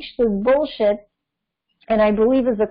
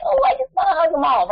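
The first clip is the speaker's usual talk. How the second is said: cooing over babies; 6 semitones higher than usual. Retold or imitated. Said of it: "you're like".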